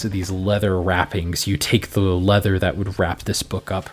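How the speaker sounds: low, even scene-setting voice; game master narration. A narrator's reading. GM describing the room to the player, low and even.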